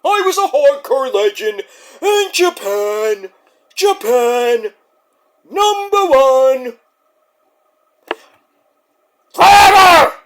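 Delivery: put-on an old hardcore legend working himself up to screaming; Terry Funk impression. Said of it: "Terry Funk right there".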